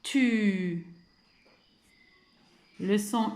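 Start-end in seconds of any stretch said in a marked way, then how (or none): 0.0-0.9 "Tu" held long on the u; drawn-out syllable. Shown as a demonstration. Teacher asking for the last sound, "Tu" stretched out. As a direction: sustain the vowel at the end of "Tu". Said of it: "le son que vous entendez en dernier".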